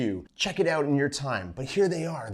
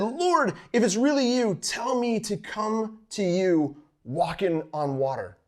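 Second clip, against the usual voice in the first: eager plea; 7 semitones higher than usual. Retold or imitated. Peter calling to Jesus from the boat, high and eager. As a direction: call out boldly across the water. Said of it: "He said".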